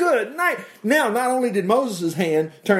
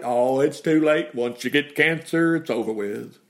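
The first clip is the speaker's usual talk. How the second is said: resigned hopeless; 4 semitones lower than usual. Retold or imitated. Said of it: "wouldn't Aaron say".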